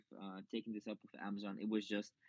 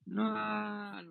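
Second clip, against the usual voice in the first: sudden high loud no; loud protest; 11 semitones higher, 7 dB louder than usual. Real, not acted. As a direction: blurt out a playful no. Jokey refusal to talk San Diego.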